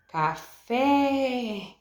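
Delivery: CAFÉ called high, final FÉ drawn out; calling out at gate. Shown as a demonstration. Teacher demonstrates stressed syllable to students, calling CAFÉ with FÉ prolonged. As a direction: call CAFÉ from the gate, holding the last syllable. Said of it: "chamando essas palavras no portão".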